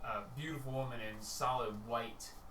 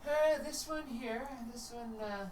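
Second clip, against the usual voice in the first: Tia's high voice; 11 semitones higher than usual. Acted. Tia answering the Justicer, high and hesitant. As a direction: stall in a high silly voice. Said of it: "Tia looks and says".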